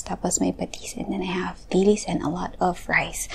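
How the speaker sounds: soft ASMR whisper. Real, not acted. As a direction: whisper close to the mic. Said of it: "I'm just gonna be whispering".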